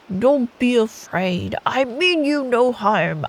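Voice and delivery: slow, lightly voiced bear; a gentle beast, slowly calming the girls. Storyteller voicing the bear to the girls, slow and mild. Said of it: "the bear's voice would add to the immersion".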